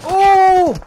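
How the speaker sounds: sudden loud high yelp; startled shout. Real, not acted. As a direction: yelp in shock. Sudden hit in gunfight.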